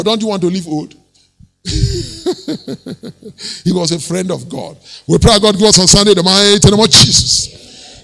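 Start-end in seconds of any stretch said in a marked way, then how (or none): 1.7-3.6 1 chuckle. Real, not acted. Preacher laughs. 5.1-8.0 1 loud prayer. Acted.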